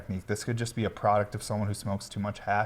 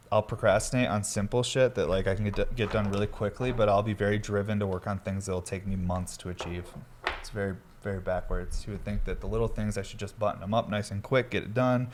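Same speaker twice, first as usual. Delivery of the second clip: ordinary talk, nothing marked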